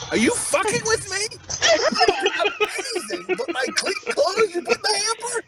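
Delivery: high-pitched incredulous rant; outraged dad. Retold or imitated. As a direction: squeal it in hammed-up disbelief. Acts outraged dad at son.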